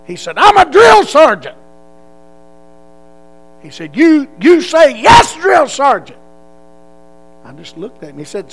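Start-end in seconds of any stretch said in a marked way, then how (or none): 0.4-6.2 a drill sergeant yelling at a recruit how to answer. Retold or imitated. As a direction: drill it into him.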